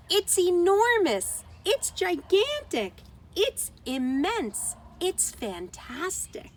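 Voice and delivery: high, loud and slow, then fading; joyful cries of wonder, building down; shouting with joy. A narrator's reading. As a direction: mice marvelling at the giant cheese, joyful shouts. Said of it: "they shouted with joy".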